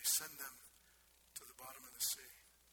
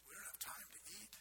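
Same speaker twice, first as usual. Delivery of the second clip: whisper; 4 semitones higher, 13 dB quieter, faster than usual. Shown as a demonstration. Whispered answer to own question.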